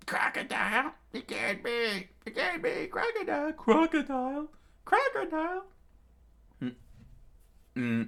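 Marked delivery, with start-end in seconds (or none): 0.1-5.6 very high, squealing pig pirate; high-pitched cartoon pig falsetto. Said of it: "how can I pull off a pig voice".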